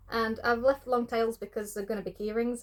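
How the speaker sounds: ordinary talk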